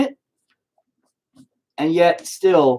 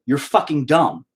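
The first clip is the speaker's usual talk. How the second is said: usual speaking voice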